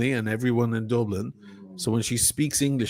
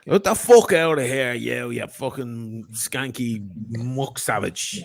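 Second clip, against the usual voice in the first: Conor McGregor impression; slower than usual. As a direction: bark it like a hard Dublin brawler. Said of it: "Irish, uh, Conor McGregor".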